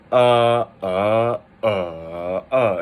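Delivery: "uh" intoned as four stiff textbook tones; over-articulate "uh" in each of the four Chinese tones; exaggerated four tones. Shown as a demonstration. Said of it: "that exaggerated".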